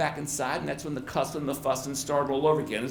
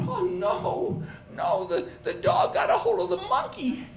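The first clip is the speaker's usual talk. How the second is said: alarmed. Retold or imitated. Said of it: "I thought".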